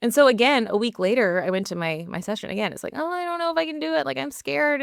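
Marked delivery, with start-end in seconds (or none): 3.0-4.8 whiny. Retold or imitated. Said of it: "whiny energy".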